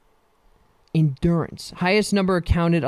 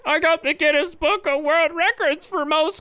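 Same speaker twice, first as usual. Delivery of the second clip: pitched up, smug bragging voice; 9 semitones higher than usual. Pretend. At a family outing, bragging in a put-on voice. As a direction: show off the silly record proudly. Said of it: "I'm like".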